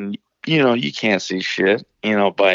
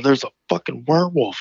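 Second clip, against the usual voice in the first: high, pleading mock distress; imitating near-tearful brother; 6 semitones higher than usual. Brother insisting to brutal dad, high and nearly tearful. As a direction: plead it as if close to tears. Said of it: "about to tear up in the face".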